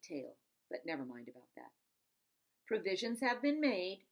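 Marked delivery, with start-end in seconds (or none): 2.7-4.1 Granny's pronouncement. Acted. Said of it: "said Granny".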